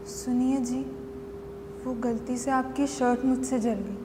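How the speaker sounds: soft, low, hesitant address; timid confession. Acted. Wife owning up to husband about his burnt shirt, meekly. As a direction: break it to them meekly, keeping soft and low. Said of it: "और मैंने उससे कहा".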